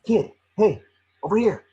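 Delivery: urgent high call for attention. Acted. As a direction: shout over to the kid to come look. Pikachu calls Tim across lab.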